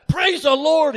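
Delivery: high, loud joyful shout of the phrase; retold shout. The professor shouting praise at the student's bad news. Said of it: "he shouted".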